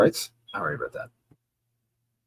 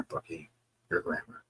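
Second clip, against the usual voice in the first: ordinary delivery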